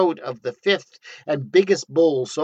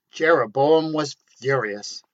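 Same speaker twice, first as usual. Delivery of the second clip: ordinary delivery, nothing marked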